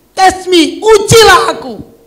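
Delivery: loud, high and slow, like God daring the people; God's voice thundering test me; booming divine challenge. Acted. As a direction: proclaim it slowly from the pulpit.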